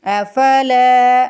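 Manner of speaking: अफला with the ला drawn long; long lam. Shown as a demonstration. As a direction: stretch ला in अफला. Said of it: "लाम को खींच के अदा करेंगे". (अफला में लाम को खींच कर)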